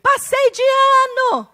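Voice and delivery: put-on high, slow child's proud announcement; excited child. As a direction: play the kid bursting with good news. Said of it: "O filho chega".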